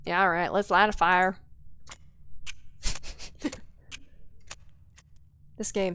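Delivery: ordinary talk, nothing marked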